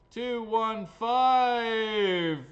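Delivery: loud, high, very slow number call; drawn-out announcer shout. Acted. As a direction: bellow it, stretching the last word. Winning number called out for show.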